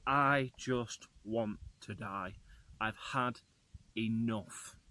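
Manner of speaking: slow, soft, defeated sigh of words; defeated mumble. Retold or imitated. Humiliated driver to herself after stalling the van, slow and defeated. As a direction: wish the ground would swallow you. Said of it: "I'm just like".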